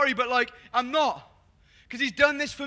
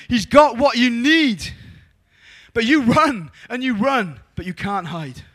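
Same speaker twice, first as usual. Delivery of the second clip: full-force preaching; 7 dB louder than usual. Acted. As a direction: preach it out big to the crowd.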